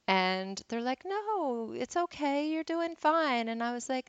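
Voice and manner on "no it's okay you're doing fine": high, coaxing voice of someone soothing; retold reassurance. Other painters comforting a tearful beginner, imitated reassurance. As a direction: imitate kindly reassurance in a much higher voice.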